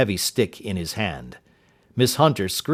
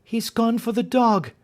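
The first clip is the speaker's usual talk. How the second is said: cried out; 13 semitones higher than usual. Acted. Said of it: "cried Miss Hunter".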